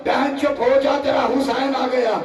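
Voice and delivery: high, near-shouted wailing recitation; full-force lament. Acted. Preacher voicing Hussain comforting his sister, high wailing cry. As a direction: wail it out over the crowd.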